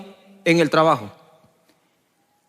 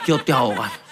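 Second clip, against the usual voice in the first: lower, softer, amused calm voice of Jesus; a lower, gently teasing reply from above; Jesus answering; 11 semitones lower, 3 dB quieter than usual. Retold or imitated. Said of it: "Jesús se reía". (Jesús respondiendo más grave y suave, divertido)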